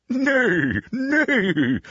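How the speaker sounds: high-pitched imitated horse neighing; horse's neigh. A narrator's reading. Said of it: "said the horse".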